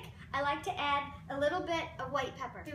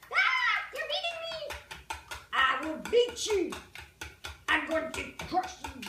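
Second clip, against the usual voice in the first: playful mock battle; 5 semitones higher, 4 dB louder than usual. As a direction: play both sides of a silly fight with the eggs. Playful mock fight with the eggs.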